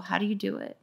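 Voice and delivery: ordinary talk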